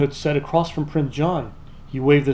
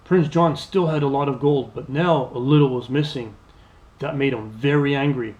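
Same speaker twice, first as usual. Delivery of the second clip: ordinary delivery, nothing marked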